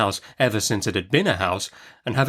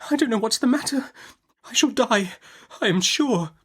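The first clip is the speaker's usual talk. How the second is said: put-on high, helpless young woman; lightly voiced fearful young mother; frightened mother; 13 semitones higher than usual. Young mother to Betsy, helpless and afraid.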